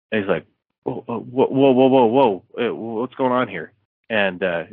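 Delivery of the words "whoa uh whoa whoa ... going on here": higher, stammered string of whoas; mild mock alarm. Storyteller voicing a man facing a gun, mildly alarmed. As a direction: play at backing away from danger.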